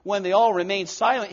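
usual speaking voice

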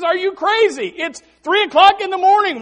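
high, loud disbelief; mock outraged yell. Sleeper scolds his friend at the door, mock outraged yell. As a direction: act out a sleeper's outburst.

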